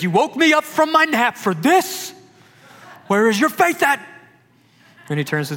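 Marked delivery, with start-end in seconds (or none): 0.0-4.0 loud, high-pitched exasperated outburst; hammed-up fed-up rebuke, slowed for punch; frustrated. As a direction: scold them, voice shooting up high. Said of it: "boil over from the frustration Jesus had".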